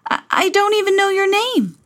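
put-on desperate young prince; pleading prince. Prince calls after Cinderella, high and pleading. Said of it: "called the prince".

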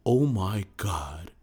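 slow, breathless whispered gasp of disbelief; mock stunned whisper. Flat-earther stunned when the laser hits the sign, slow whisper. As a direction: whisper it slowly like you can't believe your eyes.

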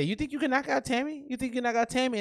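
high-pitched retold taunt; mock challenge. Retelling his angry challenge to her.

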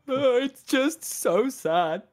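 slow, hammed-up crying; mock sobbing like a yawn; melodramatic crying. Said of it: "supposed to be melodramatic crying".

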